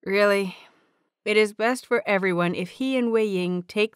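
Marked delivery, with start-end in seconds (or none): none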